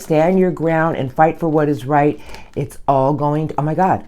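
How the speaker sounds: normal talking voice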